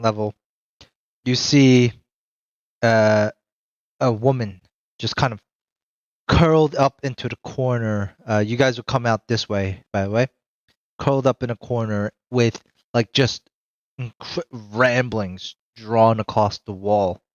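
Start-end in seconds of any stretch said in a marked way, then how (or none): none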